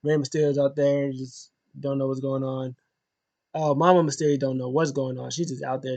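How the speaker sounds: ordinary talk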